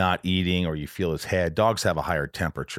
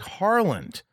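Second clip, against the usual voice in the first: insistent reply; 7 semitones higher, 3 dB louder than usual. Retold or imitated. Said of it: "I'm like".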